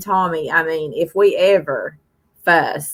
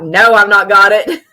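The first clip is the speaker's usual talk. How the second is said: laughing retold reply; 4 semitones higher, 6 dB louder than usual.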